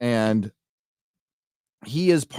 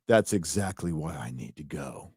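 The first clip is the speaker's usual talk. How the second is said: mock hero line; 7 semitones lower, 8 dB quieter than usual. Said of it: "And they go".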